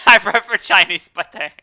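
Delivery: laughing, stubborn preference; loud playful insistence. Real, not acted. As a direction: proclaim your favourite name loudly. Insisting on the French name.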